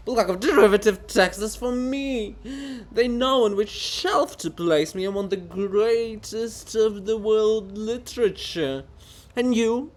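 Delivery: high, loud, self-important rant; haughty author defending his greatness; pompous offended writer. Acted. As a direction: puff up with wounded vanity. Bulgakov boasts.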